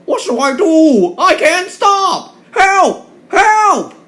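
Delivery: very high, loud, slow cries of panic; panicked. Acted. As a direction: scream for help, terrified and out of control. Runaway engine with no driver.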